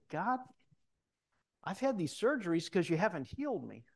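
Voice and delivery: a mock-indignant cry up to heaven; complaint to God.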